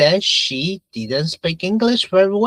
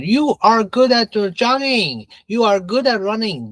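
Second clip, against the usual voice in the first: higher-pitched, louder insistent objection; arguing student; 7 semitones higher, 3 dB louder than usual. Acted. Student arguing with the president about sports, insistent and high. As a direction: argue back as the student, pitched up. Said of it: "the student argued".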